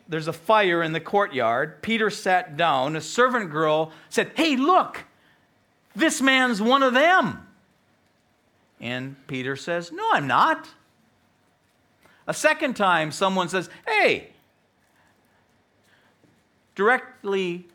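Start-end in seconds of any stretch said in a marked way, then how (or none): 4.4-7.5 high, loud cry of accusation. Retold or imitated. Preacher voices servant girl's accusation. 9.9-10.7 loud, high protest; acted protest, pitched up; high-pitched denial. Preacher acts Peter's first denial. 13.9-14.3 high, loud hail; accuser's shout for attention; calling out. Retold or imitated. Accuser calls out to Peter.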